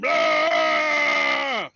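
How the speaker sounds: long, high, loud mock roar; monster roar. Game master acting the monster turning on the cleric, loud roar. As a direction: let out a big drawn-out bellow. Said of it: "and he turns and".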